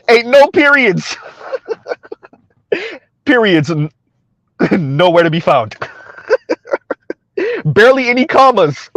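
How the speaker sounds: cracking up between phrases; real amused laughter breaking into the words; laughing. Real, not acted. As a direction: laugh through the joke as you pile on. Cracking up at a viewer's joke.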